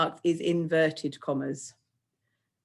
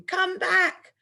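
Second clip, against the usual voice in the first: much higher, louder shout of come back; 13 semitones higher, 3 dB louder than usual. Shown as a demonstration. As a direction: yell it at whoever is running off. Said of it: "he's definitely going to be shouting".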